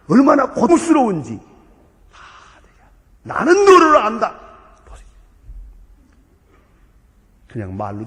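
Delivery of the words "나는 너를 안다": high, loud ringing declaration; loud proclamation. Acted. Preacher voicing the one who understands, loud. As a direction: declare it with full force.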